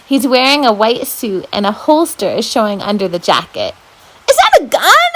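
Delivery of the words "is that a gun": high squeaky whisper of alarm; high frightened squeak. Acted. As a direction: squeak it out, scared. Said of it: "I squeak".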